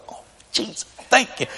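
breathless whisper of thanks; whispered relief. Retold or imitated. Teen whispers thanks on seeing dad arrive.

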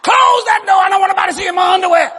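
high, loud, fast nagging wife; mock wife's shout. Wife yelling at husband about room service, high and loud. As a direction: shriek at him to shut the door. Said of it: "wife's words in story".

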